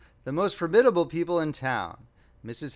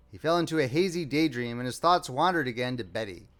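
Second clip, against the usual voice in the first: ordinary delivery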